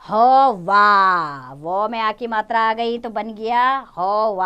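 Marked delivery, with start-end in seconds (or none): 0.0-1.5 हवा with the final आ stretched very long; very slow demo. Shown as a demonstration. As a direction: draw the word out for children. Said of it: "आ का sound आ रहा है".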